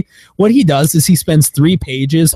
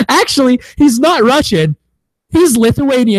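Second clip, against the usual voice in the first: higher-pitched imitation of a film explaining itself; mock backpedal; 9 semitones higher than usual. Movie excusing the missing Russian accent, mock correction. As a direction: correct them in a raised mock voice. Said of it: "where they're like".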